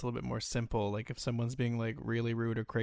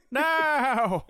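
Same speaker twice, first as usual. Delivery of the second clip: loud, very high outburst; hammed-up imperious cry; melodramatic diva command; 14 semitones higher, 8 dB louder than usual. Finishing the order for the axe, loud melodramatic cry.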